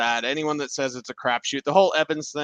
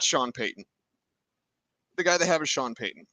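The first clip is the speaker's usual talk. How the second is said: ordinary delivery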